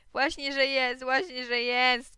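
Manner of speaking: put-on loud, high, drawn-out childish protest; defiant shout. A narrator's reading. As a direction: insist loudly like a wailing boy. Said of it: "krzyknąłem". (głośny, przeciągły krzyk sprzeciwu)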